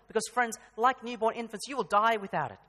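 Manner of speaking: rising passionate sermon voice; full-force preaching. Acted. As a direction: drive the point home to the congregation. Urgent preaching climax.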